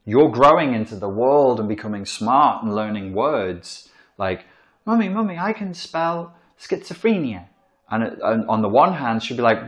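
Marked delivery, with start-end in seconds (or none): 4.8-7.5 put-on child voice. Child proudly showing off to mummy, high. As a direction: chirp it like an excited small child.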